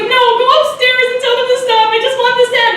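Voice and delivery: high, hurried imitation of panic; mock desperate plea. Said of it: "Jeremy's like".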